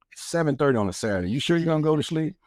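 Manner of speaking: quick, high-pitched disbelieving question; imitating incredulous wife. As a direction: rattle it off as the doubting wife. Voices his wife's disbelief.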